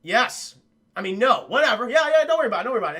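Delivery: loud, rambling mock of a drugged man; loud jittery brush-off; loaded Cage. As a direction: blurt it out wired and careless. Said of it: "fucking loaded on pills and caffeine".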